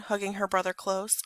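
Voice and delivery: ordinary delivery, nothing marked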